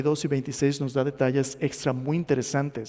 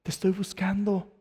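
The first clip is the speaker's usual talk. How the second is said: higher, insistent divine appeal; God pleading; 6 semitones higher than usual. Acted. God tells blind Saulo he has sought him, higher insistent appeal. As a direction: plead your case with the pitch raised. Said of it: "Dios le ha estado diciendo".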